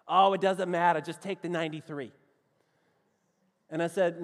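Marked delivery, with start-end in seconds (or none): 0.1-2.1 brusque imitation of a Boston accent; dismissive mock Bostonian; Boston local accent. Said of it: "here were the directions they gave me".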